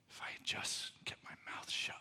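rueful whisper to oneself; hushed, regretful aside. Acted. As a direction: whisper it as a private self-reproach. Acts out opposite regret, whispered.

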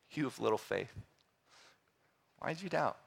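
soft, quiet, playful rebuke; a hushed, tender scolding; softly teasing reproach; gentle rebuke. Retold or imitated. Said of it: "this gentle, perhaps even playful rebuke".

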